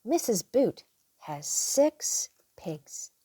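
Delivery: ordinary delivery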